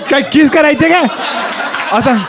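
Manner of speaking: asked high through laughter; laughing question. Real, not acted. Laughing as he asks the barber about kissing.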